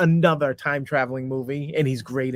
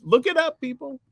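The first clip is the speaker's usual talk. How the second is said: emphatic; 11 semitones higher, slower than usual. Real, not acted. Insisting the story is true.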